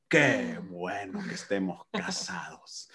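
slow, dreamy whisper. Retold or imitated. Husband re-enacts gazing at his ring, slow contented whisper. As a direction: whisper it slowly, savouring the thought. Husband retells morning thoughts of new bride.

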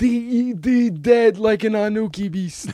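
high, theatrical taunt; over-the-top movie line; hammed-up movie threat. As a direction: play a mock threat pitched well up.

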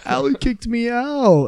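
high, drawn-out imitated drunken wail; mock crying. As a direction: whine it slowly, mimicking a crying drunk. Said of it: "walking down the street crying".

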